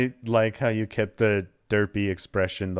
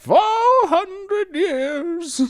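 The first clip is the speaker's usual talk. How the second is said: very high, slow, loud wail; shouting Santa; 7 dB louder, much slower than usual. Acted. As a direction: protest at full volume, dragging it out. Santa insists he's good.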